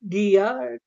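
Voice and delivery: ordinary talk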